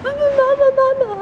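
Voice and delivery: very high, loud crying call of mama; high pleading mama, mama, mama; little girl crying mama. Retold or imitated. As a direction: imitate a small child wailing for her mother. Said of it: "her little girl goes".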